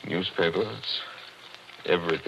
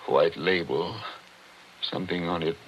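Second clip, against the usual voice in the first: usual speaking voice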